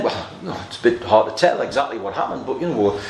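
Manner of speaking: sheepish officers. Acted. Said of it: "You can almost hear the response of the men".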